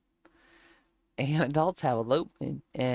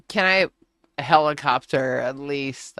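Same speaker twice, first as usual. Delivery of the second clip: louder, pleading bargain; loud plea; 7 dB louder than usual. Retold or imitated. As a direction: ask loudly for a last chance. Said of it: "I was like".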